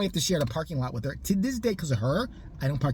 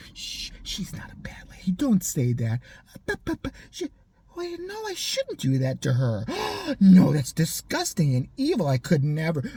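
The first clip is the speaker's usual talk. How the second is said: put-on talking to an imaginary baby. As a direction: whisper to a pretend baby, then gasp in horror. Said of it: "an imaginary baby".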